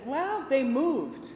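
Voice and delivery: patiently reminding confused residents; slow, patient reminder. Retold or imitated. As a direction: spell it out gently and slowly.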